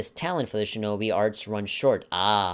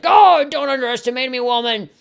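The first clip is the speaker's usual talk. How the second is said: high, loud hammed-up villain yell; hammed-up yell of a haughty brother; mock angry yell; 14 semitones higher, 10 dB louder than usual. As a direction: bellow it like a puffed-up rival brother. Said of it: "He yells with a glare in his eyes".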